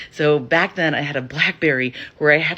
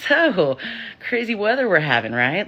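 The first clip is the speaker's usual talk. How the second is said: high, forced chirpy small talk; acted-out nervous filler chat; awkward small talk; 6 semitones higher than usual. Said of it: "grasping at straws to".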